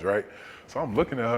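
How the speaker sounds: ordinary delivery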